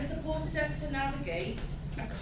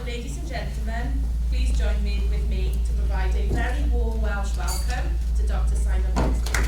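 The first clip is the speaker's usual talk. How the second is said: loud, ringing announcer call to welcome the speaker; announcer introduction; 9 dB louder than usual. Real, not acted. Host calling the room to welcome Doctor Simon Baxter, loud announcer voice. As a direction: project it to the back of the hall.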